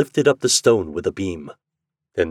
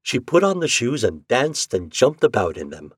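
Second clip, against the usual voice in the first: ordinary delivery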